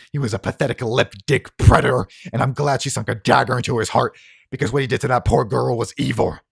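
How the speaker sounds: loud, building denunciation; angry tirade. Acted. Harlan denounces Baraka at gunpoint, loud anger. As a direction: build from firm to loud fury.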